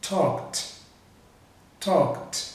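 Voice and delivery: "talked" as one syllable ending in KT. Shown as a demonstration. Teacher modelling regular past tense, "talked" ending in T. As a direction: end "talked" on a crisp T, no vowel before it. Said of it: "com som de T".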